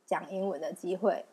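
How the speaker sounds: ordinary delivery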